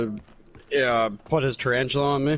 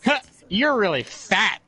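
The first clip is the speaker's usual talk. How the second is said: put-on toddler voice; 4 semitones higher than usual. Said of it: "looked at her and went".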